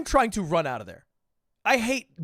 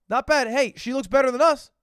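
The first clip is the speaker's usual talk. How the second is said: excited praise; 5 semitones higher, 5 dB louder than usual. Real, not acted. Excited reaction to photo.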